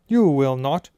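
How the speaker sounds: loud, curt refusal; sharp snap. A narrator's reading. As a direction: shut him down hard. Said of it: "snapped Missus Weasley".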